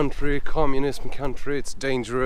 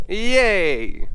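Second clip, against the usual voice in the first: forced cheer; 9 semitones higher than usual. Shown as a demonstration. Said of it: "Let's try to get my mood up".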